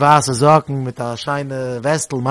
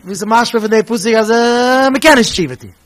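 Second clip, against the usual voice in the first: pupil asking; 5 semitones higher, 6 dB louder than usual. Retold or imitated. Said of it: "jede Jüngel hat ihm gesagt".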